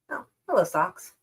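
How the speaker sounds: ordinary talk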